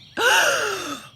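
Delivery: sharp, high gasp like waking from a nightmare; startled gasp. Retold or imitated. Retelling how the girl woke: sharp high gasp. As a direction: gasp loudly as if jolted upright. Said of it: "almost like ... the movies where the mummies".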